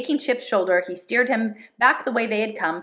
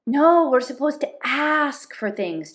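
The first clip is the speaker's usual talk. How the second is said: put-on timid boy; 7 semitones higher, slower than usual. Chip objecting, timid.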